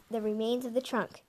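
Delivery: ordinary delivery, nothing marked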